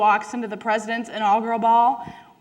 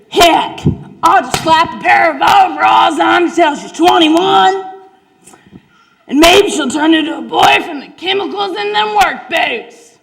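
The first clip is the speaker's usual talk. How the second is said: louder, higher hollering farmer dad; hollering country dad; 7 semitones higher, 10 dB louder than usual. Acted. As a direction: bellow it like a stubborn farm dad who won't give up. Dad's plan voiced in story.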